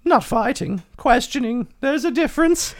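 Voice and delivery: Margot character voice. Acted.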